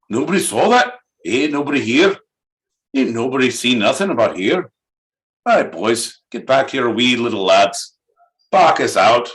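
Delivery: put-on swearing brawler in a Scottish accent; rough Scottish drunk, cursing. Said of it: "dipping from Scotland right on down".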